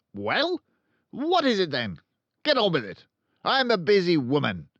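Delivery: loud, high, impatient orders; Trunchbull barking. A narrator's reading. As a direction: snap at her to hurry up. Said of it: "She either barked or shouted".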